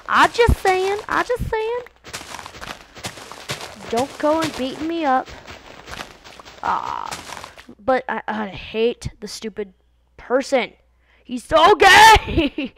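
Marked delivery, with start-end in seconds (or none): 0.1-5.3 mock high defensive voice. Vlogger squeakily defending a comment. 11.2-12.7 high, loud, drawn-out jeer; loud mocking yell. Vlogger jeering at a disliked cartoon.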